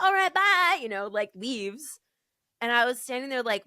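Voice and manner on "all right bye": high, loud, chirpy send-off; breezy goodbye. Retold or imitated. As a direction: imitate a cheerful, oblivious farewell. Imitating lady's cheery, oblivious goodbye.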